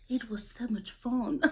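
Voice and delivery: whispered attempt at a British accent; Tom. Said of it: "I can't do his accent".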